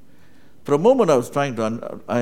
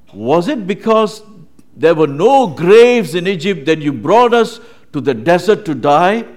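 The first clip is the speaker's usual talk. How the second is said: loud, raised-pitch challenge to a leader; mocking complaint; 6 semitones higher, 10 dB louder than usual. Israelites to Moses about dying in the desert, mocking complaint. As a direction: throw it at him as an accusing question. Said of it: "they were making fun of him and saying".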